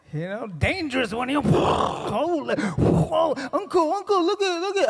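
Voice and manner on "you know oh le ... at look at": high, urgent nephew voice pointing something out; a kid tugging his uncle's sleeve, pitched way up; high-pitched eager whisper-shout at uncle; excited nephew. Retold or imitated.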